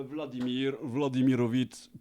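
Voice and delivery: mock solemn. Mock solemn about Putin.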